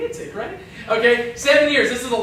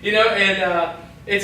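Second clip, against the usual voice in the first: ordinary talk, nothing marked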